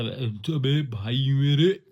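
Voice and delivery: playful Jabalpur drawl, rising at the end; stretched-out Jabalpur buddy talk; Jabalpur hometown accent. Shown as a demonstration. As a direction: slip into a funny Jabalpur accent. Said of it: "ये जो करता हूँ ना".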